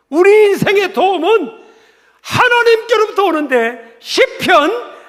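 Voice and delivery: high, loud, emphatic proclamation; forceful preaching. Real, not acted. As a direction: raise the voice and hammer each phrase. (목소리 높여 힘주어 설교)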